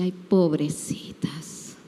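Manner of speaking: slow, breathy whisper of pity; inner scoffing whisper. Retold or imitated. Prayer leader privately pitying the praying women, whispered. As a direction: scoff under your breath, slowly. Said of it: "pero por adentro".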